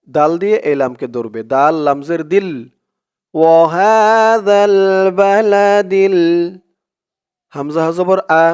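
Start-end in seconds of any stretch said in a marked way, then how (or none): 3.3-6.2 slow melodic tajweed chant, a bit higher; Quran recitation. Shown as a demonstration. Teacher reciting for viewers to repeat, slow tajweed chant. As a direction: recite it slowly so students can follow. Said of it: "recitation demo".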